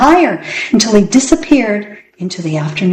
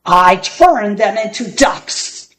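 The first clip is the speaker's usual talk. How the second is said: bellowed; slower than usual. Acted. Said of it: "bellowed Gassazi".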